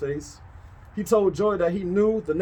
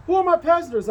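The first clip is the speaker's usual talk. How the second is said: put-on curious boy; 11 semitones higher, faster than usual. A narrator's reading. George asks Joy a question.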